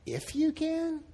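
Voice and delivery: "If you can?" pitched very high into a question; incredulous questioning inflection. Acted. Preacher showing Jesus's inflection to the dad, incredulous. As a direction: echo "If you can?" in high, mock disbelief. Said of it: "I think the inflection was there".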